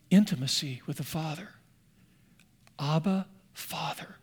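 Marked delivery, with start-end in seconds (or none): 2.8-4.2 near-whispered, intimate address; hushed reverence. Real, not acted. Preacher names intimacy with the Father, hushed. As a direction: let the word fall to a soft breath.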